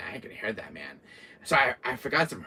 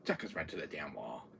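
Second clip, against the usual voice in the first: usual speaking voice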